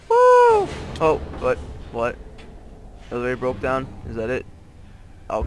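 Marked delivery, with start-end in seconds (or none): 0.1-0.7 very high, loud "woo"; excited whoop. Real, not acted. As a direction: let out a piercing whoop. Celebrates riding the elevator.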